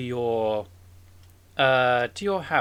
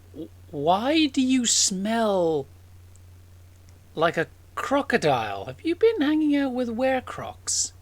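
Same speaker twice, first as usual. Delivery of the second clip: incredulous questioning; 8 semitones higher, 3 dB louder than usual. Acted. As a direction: question the smell in disbelief, pitch jumping up. Sister questions brother's crocodile smell.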